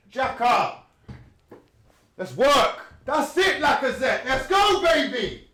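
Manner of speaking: high, rising cries of encouragement; excited cheering. Real, not acted. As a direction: cheer him on at the top of your voice. Urging Lacazette on.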